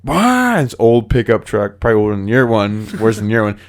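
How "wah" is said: high-pitched mock "wah"; imitated panic cry. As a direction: let out a high comic wail of alarm. Imitates driver reacting, fleeing.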